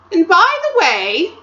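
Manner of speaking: sweet scheming queen voice; Queen's voice. Acted.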